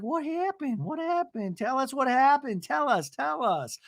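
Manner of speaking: high, insistent mock pleading; put-on high voice, not shouted; Gina. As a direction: squeak out a demand for gossip. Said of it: "And Gina's like".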